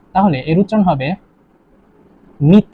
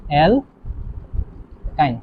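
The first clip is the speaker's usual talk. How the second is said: অ্যালকাইন very slow and softer, long gap between syllables; drawn-out dictation; 3 semitones lower, 8 dB quieter, much slower than usual. Shown as a demonstration. Teacher dictating the next compound, অ্যালকাইন slowly syllable by syllable. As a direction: pull অ্যালকাইন apart into two pieces.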